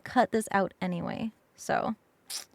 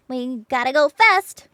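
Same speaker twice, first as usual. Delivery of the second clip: loud, rising shout; hyped shout; 9 dB louder than usual. Pretend. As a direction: shout it like a hype chant. Sonic catchphrase, loud.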